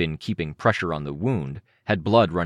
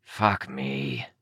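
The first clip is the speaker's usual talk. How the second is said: a touch more beastly growl; deepening growl; 2 semitones lower, 3 dB quieter than usual. A narrator's reading. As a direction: growl it out in disgust. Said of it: "Cryptid's growl became more growly".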